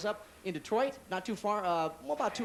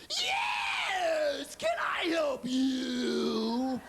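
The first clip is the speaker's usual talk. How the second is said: shrieking glove box; 7 semitones higher, much slower than usual. Retold or imitated. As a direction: screech it like a startled clerk. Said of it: "open up the glove compartment".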